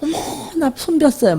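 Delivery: high-pitched, fussy little whine to husband; whiny fussing. Retold or imitated. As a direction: play up a tiny hurt for sympathy. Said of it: "남편한테 가서 옴살을 부려요".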